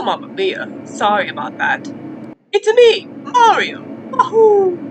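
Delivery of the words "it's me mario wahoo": high, loud cheery Mario call. Acted. Mario announcing himself to the newcomers, high loud cheer. Mario greets the new characters.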